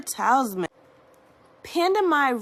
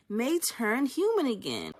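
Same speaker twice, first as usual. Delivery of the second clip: usual speaking voice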